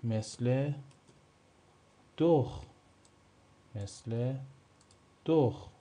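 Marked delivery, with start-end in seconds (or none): 2.2-2.6 دخ said short, doukh with a throaty kh; German doch demo. Shown as a demonstration. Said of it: "دیگه چی؟". 5.2-5.7 دخ said short, doukh with a throaty kh; German doch demo. Shown as a demonstration. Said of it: "دیگه چی؟".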